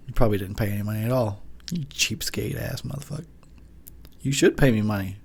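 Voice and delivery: ordinary talk, nothing marked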